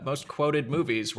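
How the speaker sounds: ordinary talk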